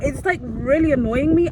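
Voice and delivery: annoyed. Real, not acted. Venting anger.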